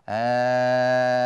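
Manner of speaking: a long held "آه", slow and steady; long drawn alif. Shown as a demonstration. Teacher showing the class the long alif sound in "آه". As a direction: stretch "آه" out like a pure long vowel. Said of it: "هذا الصوت الممدود".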